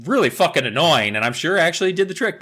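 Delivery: normal talking voice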